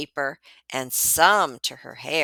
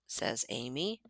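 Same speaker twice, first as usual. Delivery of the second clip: ordinary delivery, nothing marked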